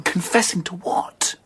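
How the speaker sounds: whispered, slow and tense; hushed whisper. Acted. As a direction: breathe the question out in a whisper. Tense discovery, hushed question.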